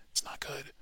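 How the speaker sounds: soft unvoiced "It's not good"; hushed warning; whispered aside. Real, not acted. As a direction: drop to a whisper.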